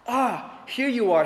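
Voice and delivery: high bright exclamation on Ah; old bishop greeting the returned thief with delight; exclaimed. Acted. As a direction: greet him with sudden delight. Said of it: "he exclaimed".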